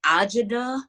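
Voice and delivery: "Ajada" as AH-juh-duh, stress up front; mispronounced name. Shown as a demonstration. Artist showing name mistakes, "Ajada" said AH-juh-duh. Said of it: "I get that a lot".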